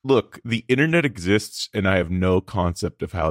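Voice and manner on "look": usual speaking voice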